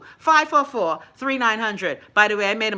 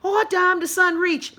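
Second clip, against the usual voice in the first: much higher, faked amazement; mock surprise; 9 semitones higher than usual. Mocking Bahamians amazed by daily sunshine, higher and fake. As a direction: gasp at the obvious like it's news. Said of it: "do you wake up in the morning and be like".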